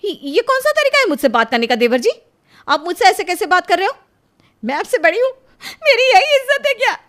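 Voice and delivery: hurt and furious at the brother-in-law; outraged tearful shout. Acted. Elder sister-in-law scolds her brother-in-law, tearful loud outrage. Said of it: "रोना बंद कर".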